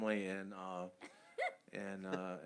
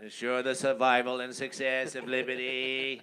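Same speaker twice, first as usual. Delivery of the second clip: a bit louder mock JFK oratory accent; Kennedy accent; 6 dB louder than usual. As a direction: declaim like JFK in Boston accent. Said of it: "never heard that accent".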